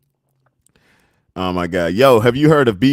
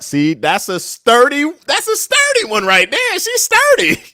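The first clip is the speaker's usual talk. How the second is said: squealing much higher on sturdy; excited sturdy; 13 semitones higher, 4 dB louder than usual. Real, not acted. Streamer hyped by chat praising a sturdy woman, squealing.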